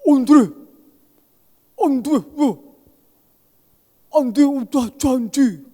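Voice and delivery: put-on high, slow, childlike whine of an orphanage resident; whining, drawn-out plea to Andre; Wahyu pleading. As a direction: beg like a simple-hearted child who feels let down. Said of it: "Karena dia bilang apa?".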